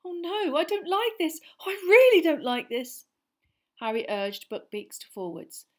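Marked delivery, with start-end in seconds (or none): 0.1-3.1 high, frightened moaning; frightened muttering. Acted. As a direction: fret anxiously while flying. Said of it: "He could hear her muttering".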